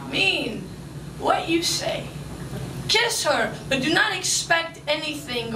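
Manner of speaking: normal talking voice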